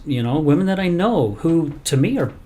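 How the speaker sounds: ordinary talk, nothing marked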